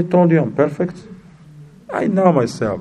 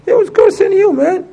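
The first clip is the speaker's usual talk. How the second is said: much higher, strained mock voice; bystander blurting the truth. Onlooker warning a man he is being cursed, high and urgent. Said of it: "Chinese says".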